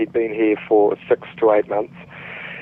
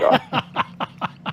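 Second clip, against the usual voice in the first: laughing; 4 dB quieter than usual. Real, not acted. Laughs answering accent question.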